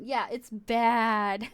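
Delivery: ordinary talk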